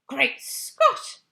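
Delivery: loud, high cry of shock. Acted. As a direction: blurt it out in alarm. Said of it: "cried the famous voice".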